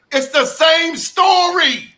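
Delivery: sudden high, loud burst of frustration; exasperated outburst. Real, not acted. Panel guest fed up with what America says: loud exasperated outburst. Heated panel rant about media.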